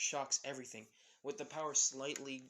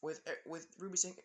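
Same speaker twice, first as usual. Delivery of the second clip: plain reading voice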